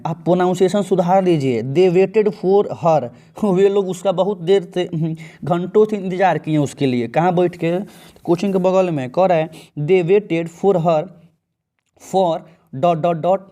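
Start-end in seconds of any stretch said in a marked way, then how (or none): 1.6-3.1 stretched out, word by word; slow dictation of the exam sentence; very slow read-aloud. Shown as a demonstration. Teacher reading exam sentence slowly. 9.8-13.4 stretched out, word by word; slow dictation of the exam sentence; very slow read-aloud. Shown as a demonstration. Teacher reading blank sentence slowly.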